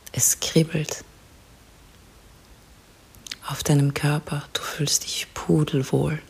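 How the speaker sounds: soft spa whisper. Acted. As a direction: whisper calmly, guide them to relax. Leads a guided relaxation.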